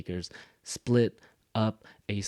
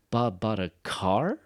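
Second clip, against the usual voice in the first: "car" swooping up into a question; surprised echo question, lifting "car"; rising surprise on CAR; 3 dB louder than usual. Shown as a demonstration. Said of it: "I actually going up".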